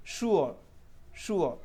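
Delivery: "श्योर" with sh and u-o, r silent; correct pronunciation. Shown as a demonstration. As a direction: say it with sh, ending on o. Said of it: "मैंने आर silent रखा". (सही उच्चारण, श से शुरू)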